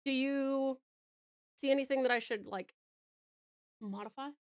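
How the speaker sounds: ordinary talk, nothing marked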